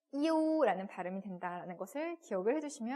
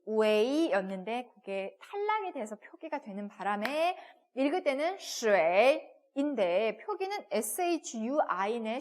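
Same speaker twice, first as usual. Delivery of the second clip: ordinary talk, nothing marked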